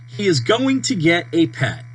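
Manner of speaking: usual speaking voice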